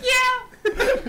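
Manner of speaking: high squeal. Retold or imitated. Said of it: "So I was like".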